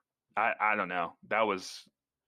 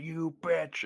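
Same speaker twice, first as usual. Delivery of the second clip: higher-pitched imitation of an evil doll; mock angry snap; 8 semitones higher than usual. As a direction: hurl the insult as Chucky. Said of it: "The first moment where Chucky snaps".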